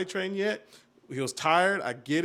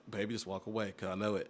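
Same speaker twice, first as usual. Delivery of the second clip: gentle aside to partner; 6 semitones lower, 8 dB quieter, faster than usual. Retold or imitated. Calming wife in retold moment.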